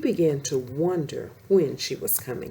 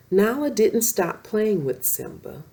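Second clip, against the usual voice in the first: plain reading voice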